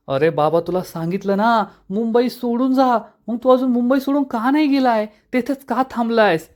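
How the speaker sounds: pitched high, shrill-edged anger at a stubborn man; angry outburst. A narrator's reading. Vallari, angry on the phone at Udaykant, high furious demand. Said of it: "Vallari चिडून म्हणते".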